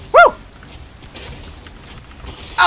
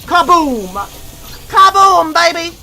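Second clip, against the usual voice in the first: gleeful kaboom; 4 dB louder, slower than usual. Real, not acted. Explosive kill.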